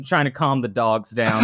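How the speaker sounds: ordinary delivery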